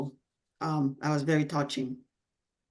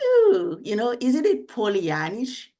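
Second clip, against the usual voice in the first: high-pitched mock nagging, opening on a loud "ooh"; nagging inner voice; 6 semitones higher, 5 dB louder than usual. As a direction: needle like a doubting voice in your head. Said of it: "this nagging voice".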